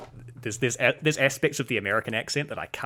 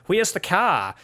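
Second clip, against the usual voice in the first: higher-pitched Australian accent demo; Australian accent with "Where's" tightened towards "wheez"; 8 semitones higher, 4 dB louder, slower than usual. Shown as a demonstration. New Zealander mimics Australians for a comparison, Australian accent. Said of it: "The Australians are like".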